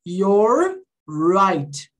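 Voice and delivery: very slow "your right", both Rs held; YOUR, RIGHT with long curled Rs; retroflex R. Shown as a demonstration. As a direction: draw out each R in "your right". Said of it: "o R tem esse som de er".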